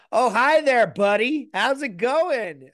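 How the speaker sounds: high, loud mock American drawl; hammed-up American accent, bigged up and drawn out; overdone mate-back-from-America accent. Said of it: "your mate who went away to, like, America".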